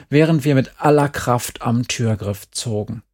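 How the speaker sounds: plain reading voice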